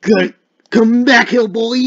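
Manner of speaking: high, full-volume holler; chasing shout. Pretend. Guard chasing a fleeing player, loud high shout. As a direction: yell after someone getting away.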